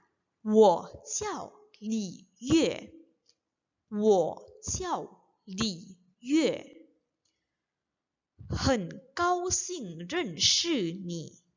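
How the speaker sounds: slow, evenly separated Mandarin syllables; read-along. Shown as a demonstration. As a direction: model it slowly for learners to repeat. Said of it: "jadi kita baca bareng-bareng ya". (baca pelan agar bisa diikuti bersama)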